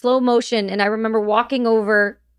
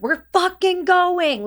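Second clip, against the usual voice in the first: urgent insistence; 10 semitones higher, slower than usual. Retold or imitated. Retold urgent demand to leave.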